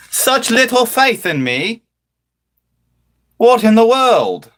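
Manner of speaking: high, drawn-out disbelief; hammed-up incredulous outrage; mock indignation. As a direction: exclaim slowly, high, as if gravely wronged. Mock outrage at chat's allegations.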